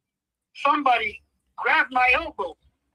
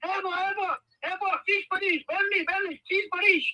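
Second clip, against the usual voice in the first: mock yelling; 9 semitones higher than usual. Man yelling into the neighborhood at night, mock yell. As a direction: imitate someone calling out excitedly, pitched well up. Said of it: "he starts yelling".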